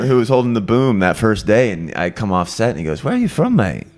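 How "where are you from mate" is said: imitated British accent, casual question; lightly put-on British "mate"; quick British crew-member impression; British sound guy. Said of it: "there was a sound guy".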